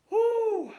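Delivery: loud, high "whoo" exhale; overwhelmed whoo. Real, not acted. Concertgoer recalling an unamplified voice, overwhelmed whoo. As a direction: let out a big high whoo. Moved by remembering the singing.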